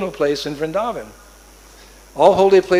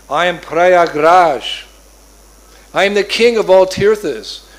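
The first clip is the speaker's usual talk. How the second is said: slow, louder, grand kingly announcement; regal proclamation; 7 dB louder, slower than usual. Acted. Prayag declaring himself king to Nanda, grand proclamation. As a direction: announce yourself like a mighty king.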